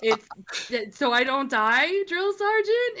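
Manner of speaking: sheepish laughing answer. Retold or imitated. Recruit answering the drill sergeant about her locker pharmacy, sheepish laugh. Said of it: "And I'm like".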